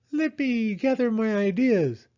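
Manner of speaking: high owl, thinking aloud; Owl's voice. Acted. Owl about to gather ideas, high and busy.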